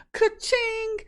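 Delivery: high-pitched drawn-out "ka-ching"; imitated cash register. Mandalorian celebrates his down payment, high ka-ching. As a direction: ring it out high like a till.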